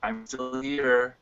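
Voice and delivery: normal talking voice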